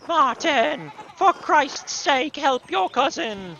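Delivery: high-pitched, exasperated call to a nephew; exasperated scolding. Acted. Aunt calling her nephew to help his cousin, exasperated scolding.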